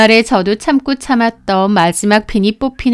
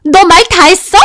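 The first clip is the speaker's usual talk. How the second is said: angry yell; 6 semitones higher, 5 dB louder than usual. Acted. Sister-in-law threatening.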